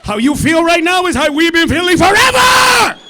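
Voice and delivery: high, loud taunt rising to a yell; triumphant shout. Acted. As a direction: holler it to the whole room.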